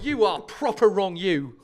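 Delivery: slow, loud, hammed-up Cockney; Cockney accent. Telling a friend off in a mock Cockney accent. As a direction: lay on a thick mock London accent. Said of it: "If they're from East London".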